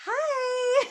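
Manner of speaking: very high, sing-song salesy hi; Tupperware voice. Retold or imitated. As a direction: chirp a fake sales-party greeting. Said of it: "her Tupperware voice on".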